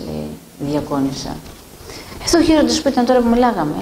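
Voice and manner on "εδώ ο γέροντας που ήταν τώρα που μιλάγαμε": quick, higher, urgent insisting; retold priest insisting the elder was just there; insistent, surprised. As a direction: rush it out in disbelief.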